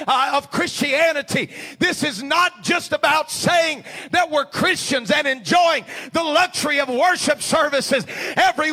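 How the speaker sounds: loud, high, building shout of a preacher; preaching at full force. Acted. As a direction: build the sermon to a roaring climax.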